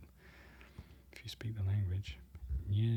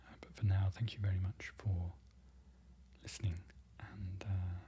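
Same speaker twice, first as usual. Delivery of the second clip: soft whispered sign-off, trailing quieter; hushed farewell to viewers; ASMR whisper; 5 dB quieter, faster than usual. Acted. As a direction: whisper your goodbye gently to the listener. ASMR outro, whispered thanks.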